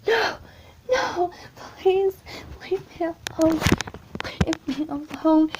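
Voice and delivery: high, loud panicked pleading; scared pleading cry out of a nightmare; frightened scream. Retold or imitated. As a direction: beg the nightmare to leave you alone. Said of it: "I scream".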